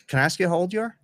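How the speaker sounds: ordinary talk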